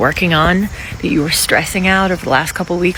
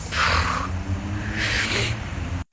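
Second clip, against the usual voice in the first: sigh; 9 semitones lower, 11 dB quieter than usual. Real, not acted. After layoff talk.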